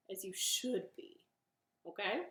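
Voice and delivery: ordinary delivery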